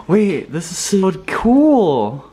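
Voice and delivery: high, loud, stretched-out gush; amazed delight. Real, not acted. Vlogger discovering something in the apartment, amazed high gush. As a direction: gush it slowly in wonder, voice way up. Gasps, delighted by what she sees.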